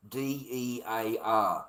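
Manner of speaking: D E A R spelled letter by letter, slowly; spelling it out. Shown as a demonstration. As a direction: spell out D E A R one letter at a time. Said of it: "it only takes one dear".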